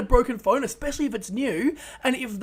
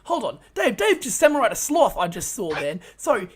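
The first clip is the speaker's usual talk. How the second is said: ordinary talk, nothing marked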